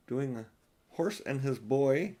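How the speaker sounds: plain reading voice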